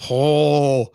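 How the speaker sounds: normal talking voice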